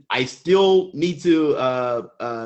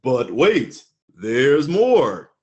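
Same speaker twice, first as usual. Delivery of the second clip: infomercial voice; 3 semitones lower, much slower than usual. Shown as a demonstration. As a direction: hype it like a TV ad. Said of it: "So I'm gonna do my little infomercial voice".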